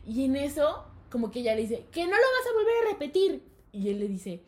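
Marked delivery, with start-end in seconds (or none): 1.9-3.4 high, loud exasperated scolding; imitating a teacher's shouted refusal; retold yelling. Teacher shutting down the student, high mock yell. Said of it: "se estaban gritando de que".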